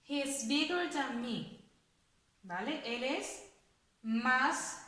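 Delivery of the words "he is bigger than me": clear, unhurried model sentence. Shown as a demonstration. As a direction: read out a little slowly and clearly at full voice. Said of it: "Vamos a ponerla en una frase".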